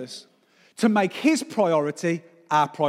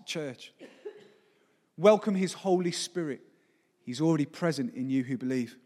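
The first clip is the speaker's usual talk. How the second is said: ordinary delivery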